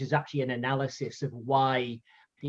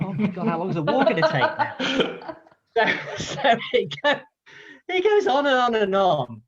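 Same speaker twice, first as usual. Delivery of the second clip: laughing through it, higher and louder; mock despair cracking into laughter; giggling at how long the old man rambles; 8 semitones higher, 6 dB louder than usual. Audience dreading endless speech.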